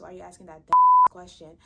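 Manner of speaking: hammed-up exasperated shout; exasperated mock yell. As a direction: blurt it out fast at the dumb question. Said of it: "And it's like".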